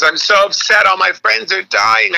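high-pitched mock lament; lamenting mom. Comic retells mom mourning friends, high mock lament.

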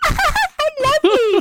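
very high, drawn-out mock glee; imitated happy witch. Witch clapping on the rocks, imitated glee. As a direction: stretch it out high and gleeful. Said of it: "like, all fucking happy".

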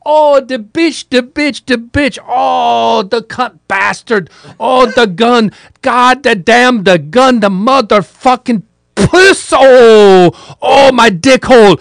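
high-pitched, loud, pained howl of curses; frantic yelps of pain and swearing. Retold or imitated. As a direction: shriek it like a man clutching a hurt shoulder. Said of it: "He's going".

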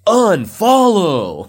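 high, slow drawn-out cry; milk it like a verdict; dramatic shout. Pretend. Dramatic unfollow exclamation.

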